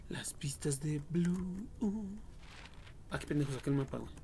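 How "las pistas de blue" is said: slow unvoiced whisper; susurro. Real, not acted. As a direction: breathe it out secretly. Reading clue text to himself. (susurro lento y secreto)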